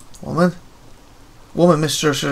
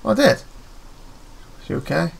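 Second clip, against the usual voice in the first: ordinary delivery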